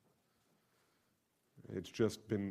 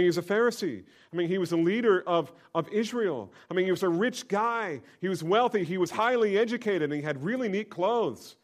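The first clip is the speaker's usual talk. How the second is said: higher, louder, fired-up preacher; excited loud preaching; 9 semitones higher, 8 dB louder than usual. Acted. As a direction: whip up the congregation. Preacher at full force.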